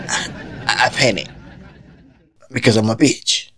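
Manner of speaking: ordinary talk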